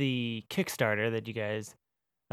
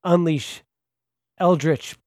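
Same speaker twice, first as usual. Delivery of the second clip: loud, raised dramatic announcer; radio announcer voice; 2 semitones higher, 6 dB louder than usual. Pretend. Host announcing the psychic class deck, radio voice. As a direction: proclaim it like a trailer voice-over. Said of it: "make you read them all that way".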